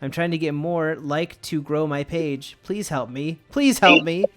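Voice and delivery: ordinary talk, nothing marked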